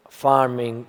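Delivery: ordinary talk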